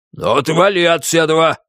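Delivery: gruff, rude brush-off; rude stranger. A narrator's reading. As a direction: cut her off rudely. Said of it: "грубо оборвал её незнакомец".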